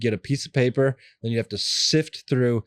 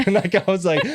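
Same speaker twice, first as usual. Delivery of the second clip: laughing; 8 semitones higher, 5 dB louder than usual. Real, not acted. Laughs retelling telling off Brian.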